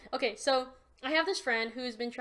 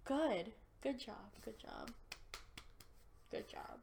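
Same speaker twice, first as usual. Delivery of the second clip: fading praise; 2 semitones lower, 11 dB quieter than usual. Acted. Teasing co-host about washing.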